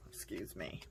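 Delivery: hoarse after coughing; choked-up whisper after a bug; just swallowed a bug. Real, not acted. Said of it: "Swallowed a bug".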